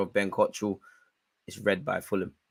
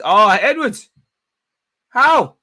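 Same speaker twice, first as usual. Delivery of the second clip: high, loud cry of disbelief; disbelieving cry; 12 semitones higher, 11 dB louder, much slower than usual. Real, not acted. Fan crying out at his striker's miss, high and loud. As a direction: shriek at the miss. Fan reacts to missed chance.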